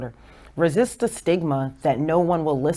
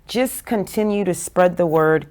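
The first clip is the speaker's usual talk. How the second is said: normal talking voice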